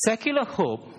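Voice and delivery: Secular pushed up high; stress on secular. Shown as a demonstration. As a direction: lean hard on Secular. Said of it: "Spiritual hope".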